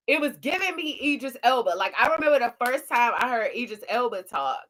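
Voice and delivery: ordinary talk, nothing marked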